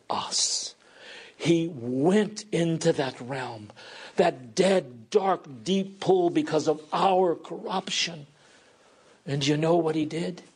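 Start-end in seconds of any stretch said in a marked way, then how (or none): none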